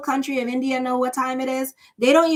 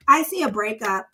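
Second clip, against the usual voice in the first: usual speaking voice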